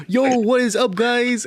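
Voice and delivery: high, pushed mock urban greeting; hamming up a cringey urban-accent hello. Mocking the SNL Baby Yoda's urban-accent greeting, high and hammed. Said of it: "I'm gonna say an urban accent".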